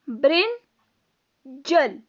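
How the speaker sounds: put-on "brinjal" drawn out slowly for children to repeat; "brinjal" stretched for choral repetition; slow model word. Shown as a demonstration. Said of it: "बोलो, साथ में बोलो ma'am के".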